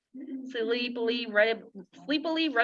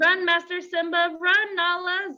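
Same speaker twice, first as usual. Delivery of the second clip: higher, louder urgent call to flee; urgent cry; 7 semitones higher, 4 dB louder than usual. A narrator's reading. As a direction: call them to run for their lives. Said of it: "Zazu cried".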